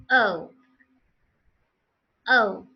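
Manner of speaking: O as a gliding "oh-oo", falling; loud, falling O with a glide; correct O. Shown as a demonstration. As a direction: say O as a diphthong closing on rounded lips. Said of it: "the actual pronunciation".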